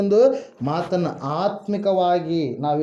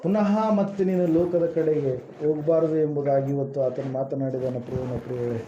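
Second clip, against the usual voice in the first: prayerful address; 2 semitones lower, 3 dB quieter than usual. Real, not acted. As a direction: pray over the people, softly and earnestly. Leads prayer after long pause.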